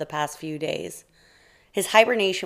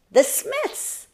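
very high, excited little mouse voice; urgent exclamation. A narrator's reading. Mouse announcing the problem to a sleepy bear, high and urgent. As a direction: squeal the name out high.

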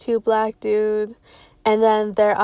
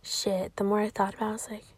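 usual speaking voice

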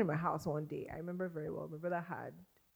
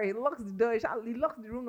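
ordinary talk

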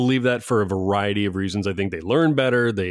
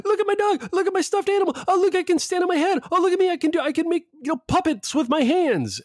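put-on squeaky, much higher voice of a showing-off little kid; be a kid hamming it up for the camera, high and silly; mock kid voice; faster than usual. Imitating kids showing off on Zoom.

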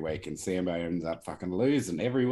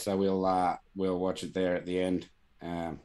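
usual speaking voice

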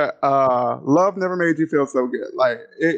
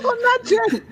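much higher laughing no; laughing refusal. Real, not acted. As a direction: shriek a laughing no. Laughing refusal of [name].

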